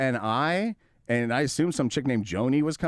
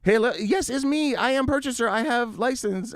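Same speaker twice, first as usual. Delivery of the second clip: much higher, chirpy put-on voice; mock broken English; 11 semitones higher than usual. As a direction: play the buyer eagerly typing back. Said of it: "and he was like".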